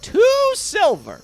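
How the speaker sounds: very high, loud, drawn-out; shopkeeper announcing price. Acted. Shopkeeper after counting on her fingers, triumphant announcement. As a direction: squeal the price slowly. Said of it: "sort of counts her fingers".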